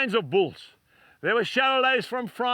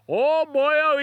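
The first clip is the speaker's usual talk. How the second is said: higher, louder imitated Welsh exclamation; Welsh accent; 5 semitones higher, 5 dB louder than usual. Welshman brushing off the preacher's question, in an imitated Welsh accent. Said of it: "He was speaking in that Welsh accent".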